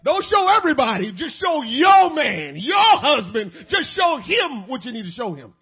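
loud emphatic sermon; forceful preaching. Acted. Preacher urging wives, loud and forceful. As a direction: drive the point home to the wives.

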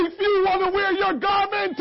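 usual speaking voice

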